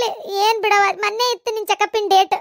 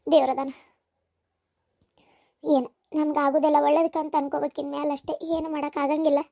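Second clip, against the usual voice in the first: low, softer voice, gently soothing; calm them down quietly; soft consoling; 5 semitones lower, 6 dB quieter than usual. Acted. Grieving daughter-in-law soothing the family, soft and low. Resigned talk after losing babies.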